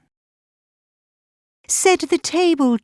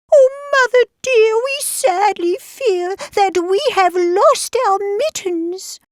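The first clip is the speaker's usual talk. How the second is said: high, far-from-natural little kitten voice; high-pitched mock crying; 10 semitones higher than usual. A narrator's reading. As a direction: wail it as tiny upset kittens. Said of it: "they began to cry".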